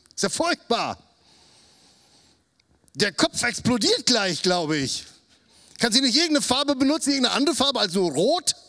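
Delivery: high, fast horrified grumbling; appalled inner thoughts. Acted. Uncle secretly appalled at his niece's drawing, high and ranting. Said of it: "dachte ich".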